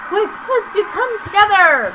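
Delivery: high loud panicked yell; panicked shout. Real, not acted. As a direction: yell the line in terror. Shouts creepy game line in panic.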